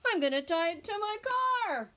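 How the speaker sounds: a cheerful dentist; dentist's voice. Acted. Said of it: "said the dentist".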